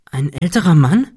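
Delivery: taken aback, pitch rising; astonished and worried. A narrator's reading. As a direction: ask with startled concern. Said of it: "fragte Cecil erstaunt und besorgt".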